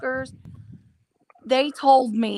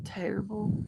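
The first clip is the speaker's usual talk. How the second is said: whispering; 11 dB quieter than usual. Real, not acted. Reacting to caller's comment.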